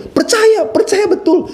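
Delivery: high, bright exclamation of belief; excited. Real, not acted. Preacher marvelling at children's belief, high pitched. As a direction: sell how fully they believe.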